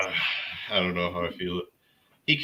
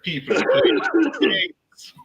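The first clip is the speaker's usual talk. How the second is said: laughing; 6 dB louder, much slower than usual. Real, not acted. Talking through laughter.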